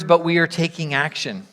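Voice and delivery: usual speaking voice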